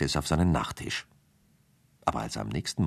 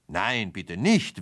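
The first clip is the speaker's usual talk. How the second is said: slow, pleading refusal; a mild, hammed-up plea; frog refusing; 7 semitones higher, much slower than usual. A narrator's reading. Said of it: "wehrte der Frosch ab".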